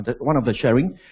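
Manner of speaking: ordinary talk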